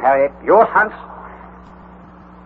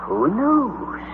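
softer musing to himself; 3 semitones higher, 6 dB quieter than usual. Acted. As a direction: wonder aloud quietly. Uncle musing.

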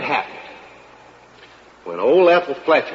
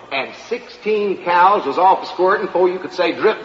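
ordinary talk

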